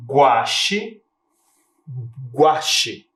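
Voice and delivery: "Guache" with the U heard as gwa; teacher sounding out "Guache"; gu sound demo. Shown as a demonstration. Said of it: "Você consegue perceber o som da letra U aqui?".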